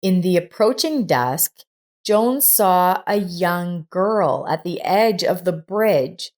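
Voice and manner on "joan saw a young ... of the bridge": plain reading voice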